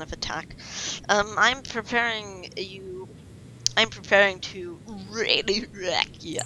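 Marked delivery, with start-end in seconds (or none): 3.8-6.4 sly whisper, voice dropped away; whispered threat. Real, not acted. Whispering a playful threat to the rival player. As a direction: whisper the threat like a card-game villain.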